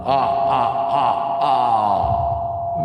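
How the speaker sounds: staccato "ah, ah, ah" vampire laugh; mock villain laugh in separate beats; vampire count laugh. Vampire count after counting guests, hammed-up laugh. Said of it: "the count".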